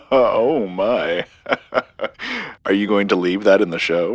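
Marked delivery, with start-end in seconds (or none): 0.0-2.7 laughing. Real, not acted. Laughing at the message.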